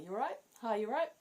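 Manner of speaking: bright, high "You all right?" with a lift at the end; breezy British hello; sing-song casual greeting; cheery greeting. Shown as a demonstration. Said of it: "You say".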